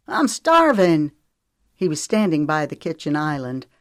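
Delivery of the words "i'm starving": slow, louder hungry complaint; exasperated. A narrator's reading. Teenage boy complaining to the adults, exasperated. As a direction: drag it out, fed up. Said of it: "asked in exasperation".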